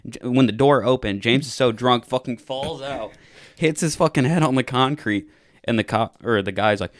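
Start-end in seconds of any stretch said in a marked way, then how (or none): none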